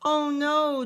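loud, high anguished wail; moaning. Acted. George moaning that he is toothless, hammed-up wail. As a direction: wail at losing the last tooth. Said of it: "George moaned".

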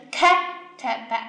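first syllable 开 stressed, te be lighter after it. Shown as a demonstration. Teacher shows students where the accent falls, stress on 开. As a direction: sound out the word with the weight on 开. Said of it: "是不是就第一个开上面".

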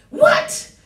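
very high, repeated squeal of amazement; each word a separate high yelp; astonished disbelief. Real, not acted. Preacher amazed by verse to congregation, astonished disbelief.

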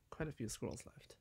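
breathy whisper. Real, not acted. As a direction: whisper to yourself. Whispering late at night.

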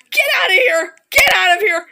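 high, loud frightened shouting; panicked yelling. Real, not acted. Gamer shouting at the monster, panicked yelling. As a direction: yell at the thing to leave, panicking. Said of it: "for that screeching hollering".

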